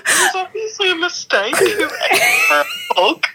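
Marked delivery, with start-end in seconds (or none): 1.6-3.2 high, laughing shriek; shrieking disbelief. Real, not acted. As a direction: squeal it out in shocked laughter. Shocked laughing outburst on call.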